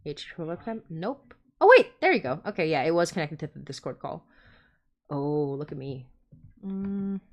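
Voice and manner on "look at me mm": ordinary talk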